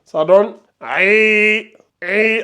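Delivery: aïn with the vowel held long and slow; aïn stretched to two counts. Shown as a demonstration. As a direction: draw out aïn. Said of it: "vous allongez de deux secondes".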